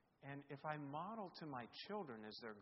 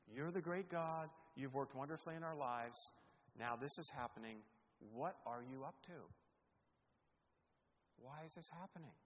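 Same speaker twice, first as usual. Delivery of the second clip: lament prayer; 3 semitones higher, 6 dB louder than usual. Acted. Model lament prayer voiced.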